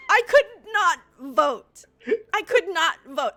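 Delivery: loud laughing cry, words stretched out. Real, not acted. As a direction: laugh through the groan of regret. Laughing over missing the vote.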